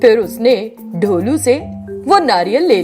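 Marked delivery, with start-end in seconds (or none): none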